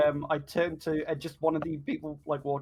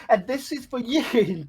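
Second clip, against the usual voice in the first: excited Reese voice; 9 semitones higher, 7 dB louder, slower than usual. Retold or imitated. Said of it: "Reese is just in the bed, like".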